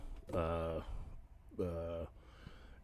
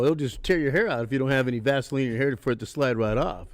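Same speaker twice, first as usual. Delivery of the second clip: ordinary talk, nothing marked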